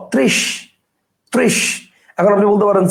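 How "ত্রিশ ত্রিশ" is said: "ত্রিশ" in isolation, ending on sh; sounded-out syllable. Shown as a demonstration. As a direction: blend "ত্রিশ" as trish, high-pitched. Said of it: "উচ্চারণ অনুসারে ভাঙি".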